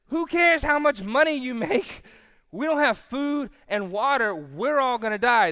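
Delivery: loud, high acted panic; hammed-up frantic castaway; freaking out. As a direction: shout down the calm man in mock despair. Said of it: "he's freaking out".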